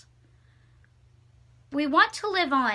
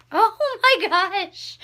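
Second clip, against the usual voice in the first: "Oh my gosh" stretched slow, higher and louder on "my"; awed gasp; 6 semitones higher, 9 dB louder, slower than usual. Real, not acted. Finding an old letter, awed and drawn-out. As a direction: let the wonder pull the words out long.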